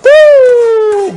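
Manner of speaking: high, loud whoop of delight; loud excited cheer. Real, not acted. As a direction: whoop with joy at the pot. Excited at the food.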